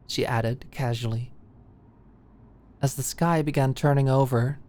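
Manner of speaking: ordinary delivery, nothing marked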